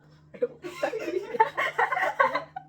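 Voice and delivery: long, high burst of laughter; real laughing fit. Real, not acted. Cracking up at the friend's accent attempt, high laughter. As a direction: crack up in loud, high peals.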